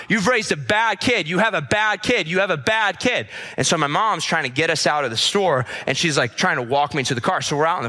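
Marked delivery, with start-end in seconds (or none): 0.1-3.3 high, insistent mock accusation; retold scolding. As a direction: imitate a woman hammering the same accusation. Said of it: "this lady just keeps saying to my mom".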